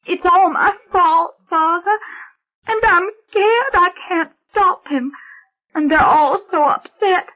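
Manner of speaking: put-on a child breaking down in fear; sobbing little girl. Girl sobs to the priest that it is her fault, very high and crying. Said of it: "Her sobs had gotten louder".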